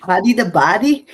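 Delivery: hamming up a Liverpool Scouser for a laugh; Scouser accent. Retold or imitated. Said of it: "use that accent".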